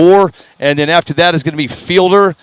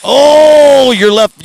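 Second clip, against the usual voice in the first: excited; 9 semitones higher, 7 dB louder, much slower than usual. Real, not acted. Race result calling out.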